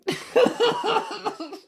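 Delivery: loud high cackle; sudden high laughing fit; burst of laughter. Real, not acted. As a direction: crack up loudly. Laughs at Bush joke.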